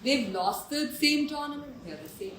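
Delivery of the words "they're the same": very soft, low deadpan; unresponsive deadpan. Retold or imitated. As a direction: let it fall away without any reaction. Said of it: "These two are like".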